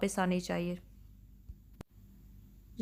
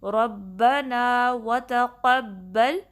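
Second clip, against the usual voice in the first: slow, drawn-out tajweed chant; measured Quranic recitation with long held vowels; 4 dB louder, much slower than usual. Shown as a demonstration. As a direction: recite it slowly and melodically, stretching every vowel. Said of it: "را کو با سے ملانا ہے".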